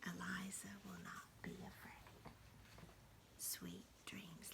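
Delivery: very soft, breathy goodnight whisper. Acted. Grammy whispering goodnight to the sleepy mouse. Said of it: "and softly whispers".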